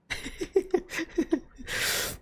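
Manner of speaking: breathless squeaky giggle; airy high-pitched wheeze of laughter; high wheezy laugh. Real, not acted. Laughing at the Chinese guy.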